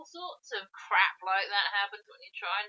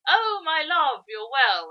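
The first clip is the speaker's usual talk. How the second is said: much higher, louder, swooning; hammed-up lovesick monkey; 6 semitones higher, 8 dB louder, slower than usual. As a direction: gush over her like a lovesick monkey. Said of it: "along comes this monkey saying".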